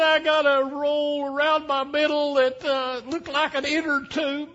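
put-on high falsetto old wife, pleading; Fanny Victoria's voice. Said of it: "She said".

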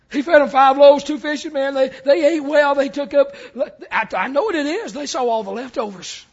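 loud, lively storytelling from the pulpit; animated preaching. Acted. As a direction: tell it big and loud to the room.

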